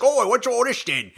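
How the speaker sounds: a hint of a chirpy Brit. Co-host mimics Bale in interviews, mock British accent. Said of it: "he's like".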